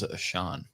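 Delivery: "Ashan" with sh, not ch; alternative pronunciation. Shown as a demonstration. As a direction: say "Ashan" with a sh sound. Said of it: "Is that how you pronounce it?".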